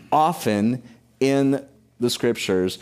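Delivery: ordinary talk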